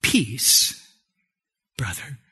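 low, hushed offer of peace; quietly bestow peace, dropping away softly; calm Jesus-like greeting. Acted. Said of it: "he did it like this".

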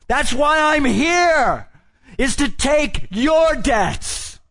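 high, loud, slow impassioned declaration; preaching at full force. Acted. Preacher voicing Jesus to the crowd, loud and high. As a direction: shout it as a cry of resolve.

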